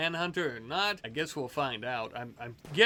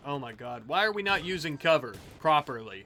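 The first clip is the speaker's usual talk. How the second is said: usual speaking voice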